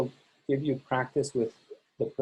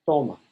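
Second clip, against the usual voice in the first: TO of "Toma" strong, ma falling; stress on stem; 2 semitones lower, 4 dB louder than usual. Shown as a demonstration. Teacher returns to the boot, TO stressed in "Toma". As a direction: hold back onto TO in "Toma". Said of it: "We hold back again".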